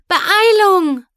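high, loud hurry-up cry; urgent shout. A narrator's reading. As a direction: urge them on, loudly. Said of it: "drängte Twilight".

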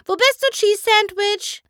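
very high, loud call with the stress on bist; eager searching call; excited call. A narrator's reading. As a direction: shout across the whole theatre for him. Pinkie calling through the theatre.

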